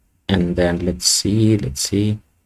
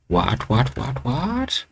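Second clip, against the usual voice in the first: climbing, much higher, softer baffled whats; baffled repeated what; 5 semitones higher, 6 dB quieter, much slower than usual. Real, not acted. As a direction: disbelief rising with each word. Layout breaks unexpectedly.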